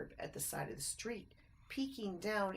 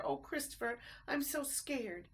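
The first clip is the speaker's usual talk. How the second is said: small and afraid; scared; 7 semitones higher than usual. A narrator's reading. Ted frightened in sewer.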